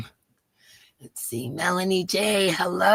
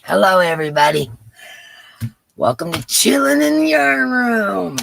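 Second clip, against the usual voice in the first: excited greeting; 4 semitones higher, 9 dB louder than usual. Real, not acted. Show opening greeting.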